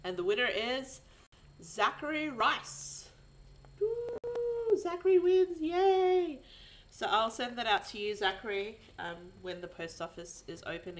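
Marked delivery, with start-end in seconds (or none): 0.0-1.0 loud, rising winner build-up; hyped giveaway announcement. Real, not acted. Redrawn winner reveal, louder. 1.6-6.4 cheering, much higher and louder; excited winner shout. Real, not acted. Announcing the giveaway winner.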